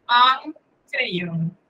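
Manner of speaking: "crayon" with a nasal vowel; nasal sound demo. Shown as a demonstration. Teacher models the nasal vowel for the class. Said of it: "Sonido nasal".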